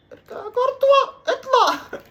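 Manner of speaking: high, loud yell at the keeper; frantic shout. Real, not acted. Gamer shouting at his goalkeeper, frantic high yell. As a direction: scream the keeper back into goal.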